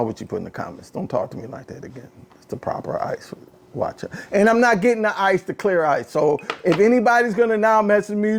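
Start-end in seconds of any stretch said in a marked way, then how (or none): 0.0-2.1 much softer, fast playful threat; teasing warning murmured to a rude commenter; mock menacing hush. 2.4-4.1 soft whisper with playful menace; whispered teasing threat to commenters; whispered mock threat.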